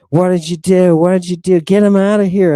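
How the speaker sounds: loud, high, urgent crowd yell; retold yelling. Parents confronting Joseph over their dead child, yelling. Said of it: "Everybody's yelling at Joseph".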